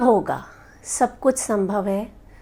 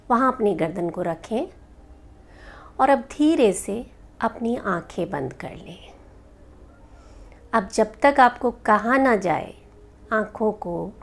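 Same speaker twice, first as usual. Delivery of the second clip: guided meditation. Acted. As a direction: lead them gently into rest, unhurried.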